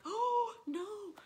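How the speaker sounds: a jolt of horror at the clue; Oh no, yelped in alarm; shocked gasp. Retold or imitated. Audience sees blood on shoe.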